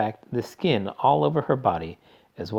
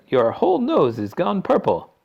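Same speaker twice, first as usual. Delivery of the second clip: alarmed father voice; 9 semitones higher, 5 dB louder than usual. A narrator's reading.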